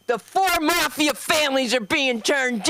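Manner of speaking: slow, high, put-on anchor voice; pompous TV news read; mock news anchor. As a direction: read it like a grave newsman. Mocking news anchor quote.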